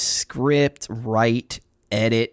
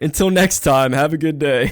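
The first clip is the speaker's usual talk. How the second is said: laughing; 4 dB louder than usual. Real, not acted. Laughing sign-off.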